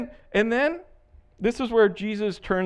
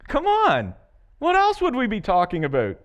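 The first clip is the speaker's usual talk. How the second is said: higher, exasperated come on; mock exasperation; 6 semitones higher, 3 dB louder than usual. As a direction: play the disbelief of the disciples. Exasperated disciples voiced.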